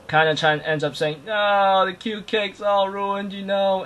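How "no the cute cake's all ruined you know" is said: high, drawn-out lament; mock wail. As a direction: whine in exaggerated dismay. Character laments ruined cake, retold.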